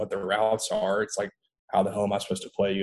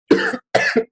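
sharp choking cough; coughing up water; 9 dB louder than usual. Real, not acted. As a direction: cough hard as water goes down the wrong pipe. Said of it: "water went down the wrong pipe".

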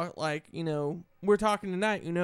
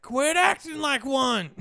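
higher, slow, emphatic mock yell; retold comeback; 6 semitones higher, 4 dB louder, slower than usual. Son re-enacting a comeback to his mother, mock-indignant. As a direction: fire it back with each word drawn out.

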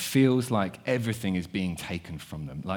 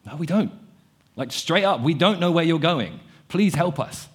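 higher, exasperated plea for help; mock-bewildered disciples pleading; desperate pleading; 6 semitones higher, 3 dB louder than usual. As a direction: beg to understand, at a loss. Disciples answering Jesus, bewildered.

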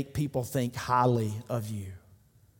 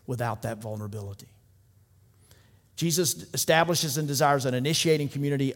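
ordinary delivery

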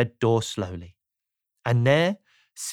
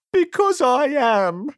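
high, slow, squeaky misery; woeful, high-pitched whine; Mister Miserable; 9 semitones higher, slower than usual. A narrator's reading. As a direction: drag it out in a sad squeak. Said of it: "replied Mister Miserable".

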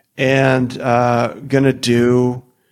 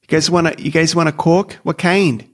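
put-on North Dakota accent; 4 semitones higher than usual. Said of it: "I think that voice is amazing".

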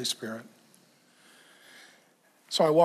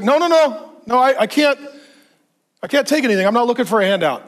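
high, loud insistent refusal; imitating a proud refusal; 8 semitones higher, 9 dB louder, faster than usual. Preacher voices the proud man turning down help, insistent. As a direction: push the help away, pitched up hard. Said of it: "He's like".

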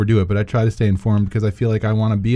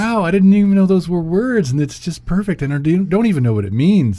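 amazed; 8 semitones higher than usual. Retold or imitated.

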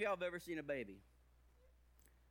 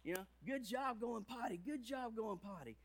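put-on high, loud cheering for a toddler; excited praise; 8 semitones higher, 3 dB louder, slower than usual. Parent cheering the toddler for going potty, high and excited. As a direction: gush over her like a proud parent. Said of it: "we'd get all excited and be like".

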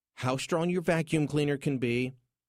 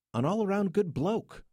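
put-on light Aussie accent, pitched higher; mock Aussie accent; 8 semitones higher than usual. Host echoing his Australian guest's slang, light Aussie accent. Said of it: "as he would say".

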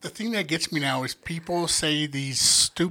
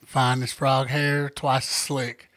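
slow, lower Southern saying; Southern accent; 4 semitones lower, much slower than usual. Retold or imitated. Telling girls a folksy line, slow Southern accent. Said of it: "she says it in that accent".

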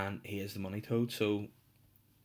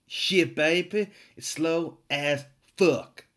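loud, high, drawn-out wail of frustration; hammed-up exasperation; 7 semitones higher, 9 dB louder, much slower than usual. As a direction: belt it out slowly with showy frustration. Hamming up internet frustration.

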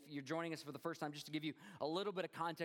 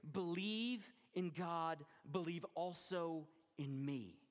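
slow reading; much slower than usual. Real, not acted. Preacher reads the verse slowly.

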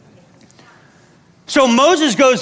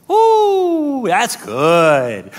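savoring approval; much slower than usual. Acted. Listener reacting to the preaching, savoring approval. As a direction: relish it, swooping down from the top.

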